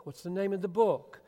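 ordinary talk, nothing marked

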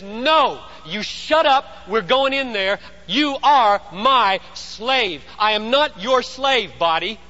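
stern shouted telling-off; rough rebuke. Acted. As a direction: order the body into submission, stressing each word. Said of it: "You don't always have to be that rough on your body".

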